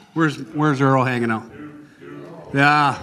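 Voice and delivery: ordinary delivery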